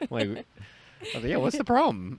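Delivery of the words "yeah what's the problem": high, playful what's-wrong voice; innocent defiance. Retold or imitated. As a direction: play dumb with a high lilt. Defiant reply acted out.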